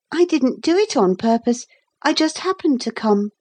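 put-on high-pitched boy's excuse; explaining boy. A narrator's reading. Boy questioned on his purpose, explaining. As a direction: excuse yourself as a small boy.

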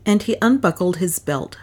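plain reading voice

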